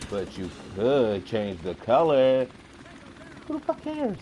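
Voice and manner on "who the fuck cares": exasperated scoff. Real, not acted. Ranting about car colours.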